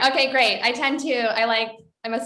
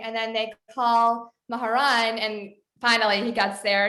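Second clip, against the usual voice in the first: usual speaking voice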